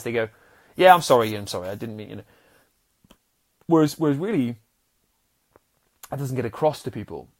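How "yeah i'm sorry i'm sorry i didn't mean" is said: loud, offhand sorry tossed off fast; dismissive sorry. Shown as a demonstration. Showing how people say sorry without meaning it: loud offhand brush-off. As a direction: brush the apology off, not meaning it. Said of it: "people do say sorry a bit like this".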